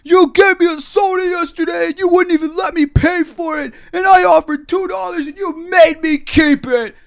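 very high, loud indignant screech; hammed-up screamed accusation; screaming match. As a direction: imitate the man shrieking his grievance. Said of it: "I'm having a screaming match".

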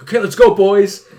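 high, loud mock rallying call; hammed-up catchphrase, punched out loud; imitating London acquaintance. Host mimics high school acquaintance, loud eager catchphrase. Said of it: "where he'd always be like".

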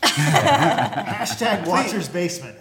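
slow, loud, high laughing announcement; slow hammed-up promo with a laugh; laughing plug. Real, not acted. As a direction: hype the channel through laughter. Plugging their show jokingly.

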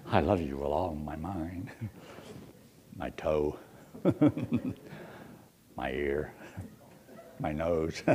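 low, soft playful endearments; low mock romance. Shown as a demonstration. Joking to the congregation, low and mock-tender. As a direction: croon silly body-part endearments.

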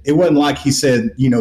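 ordinary delivery

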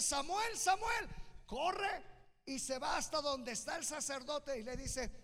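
high, loud preacher's proclamation; full-force preaching. Real, not acted. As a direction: preach it out to the whole congregation at full voice.

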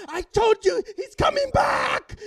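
very high, loud drawn-out shout; triumphant gloating. Retold or imitated. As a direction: shriek it in vindication, stretching the end. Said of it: "I go".

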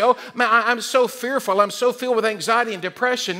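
high-pitched anxious complaint, hammed up; mock fearful. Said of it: "He didn't talk about his emotions".

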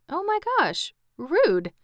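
high, aghast aside; mock shock. Real, not acted. Reader appalled at the Scarecrow's insult, mock shock. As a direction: gasp it out high, then a loud verdict.

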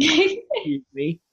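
high, slow, mock-indignant; mock offended. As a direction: act scandalised, drawn out. Mock offense at murder hobo.

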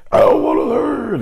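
put-on child's high bratty yell; mocking kid yell. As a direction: mimic a furious little kid refusing work. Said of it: "He starts yelling at me".

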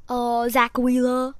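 slow, loud mock Austrian accent; Schwarzenegger. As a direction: draw the name out in an Austrian accent. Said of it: "saying it like Schwarzenegger".

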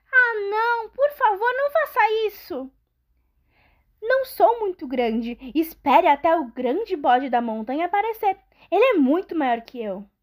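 very high, hurried begging goat; pleading goat voice. Acted. Middle goat begs the troll.

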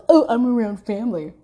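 whiny mimic of his excuse; a lightly voiced, mocking boyfriend. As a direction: put on a voice to mock his brush-off. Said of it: "but when it's a problem".

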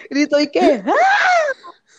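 sudden high shriek of a man bitten; a hammed-up scream of pain; mock scream. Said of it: "Gritó horrible".

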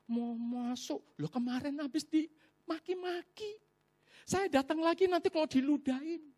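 high, mostly soft fretting; worried hesitation. Retold or imitated. Acting out his worried hesitation at gate.

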